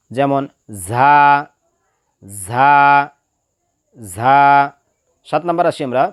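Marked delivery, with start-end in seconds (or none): 0.9-4.4 ঝা as a thin, drawn-out z; thin articulation. Shown as a demonstration. Teacher models the letter, thin sibilant ঝা. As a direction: whistle the z of ঝা lightly at the teeth. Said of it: "পাতলাভাবে আদায় হবে".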